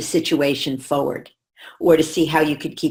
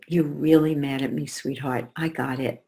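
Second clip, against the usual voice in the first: quieter, gentle understanding reply; soft validating mom; 3 semitones lower, 5 dB quieter than usual. Retold or imitated. Mother soothing angry daughter, soft and gentle. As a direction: calmly acknowledge her feelings. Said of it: "And I said".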